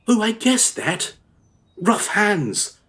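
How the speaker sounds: a hint of a young woman teasing him. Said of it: "A girl approached me".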